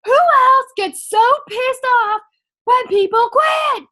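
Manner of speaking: high, slow, loud rallying call to the crowd; rallying shout. Retold or imitated. Coach Pam rousing the boot camp crowd, loud and slow. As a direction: stretch it out and rouse the room. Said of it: "And then Pam's like".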